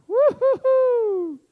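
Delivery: slow, high, loud smug woo-hoo-hoo; mock gloating whoop. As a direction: crow like a self-satisfied boss. Mocking a proud leader.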